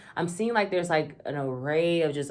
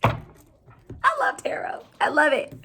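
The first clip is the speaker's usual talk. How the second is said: high, slow, breathy-free squeal of delight; gushing; 12 semitones higher, slower than usual. Real, not acted. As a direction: swoon over it. Tarot reader gushing.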